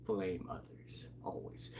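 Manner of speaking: a breathy whispered joke to herself; whispered aside. Real, not acted. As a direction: slip it out under the breath, teasing. Joking aside about blame.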